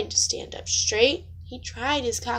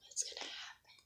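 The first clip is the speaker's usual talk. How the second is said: breathless suspense whisper; 20 dB quieter, faster than usual. Real, not acted. Reader whispering to the child before the catch. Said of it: "suspense aside".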